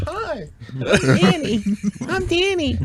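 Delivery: high, sugary friendly greeting; mock sweet guy. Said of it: "But I'm real sweet".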